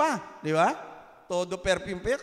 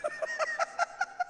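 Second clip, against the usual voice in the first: high laugh; 9 dB quieter than usual. Real, not acted. Congregation laughs at joke.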